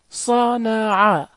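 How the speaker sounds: صنع in three long, separate syllables; sounded out slowly. Shown as a demonstration. Teacher drilling short vowels, sounded out slowly. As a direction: draw out each vowel in صنع.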